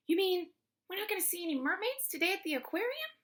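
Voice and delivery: fast, high-pitched disbelief; dismayed question. A narrator's reading. Girl asking teacher about mermaids, high and dismayed. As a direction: rush it out high, rising in dismay.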